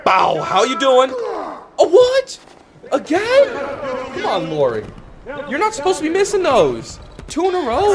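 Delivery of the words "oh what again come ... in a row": can't believe the miss, shout it high; disbelief. Real, not acted. Player misses twice in a row.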